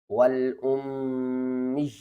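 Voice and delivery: والامی very slow, the یا pulled long; drawn recitation. Shown as a demonstration. Teacher demonstrating the long یا to students, slow. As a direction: chant والامی, stretching the vowel a full alif. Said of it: "کس طرح؟".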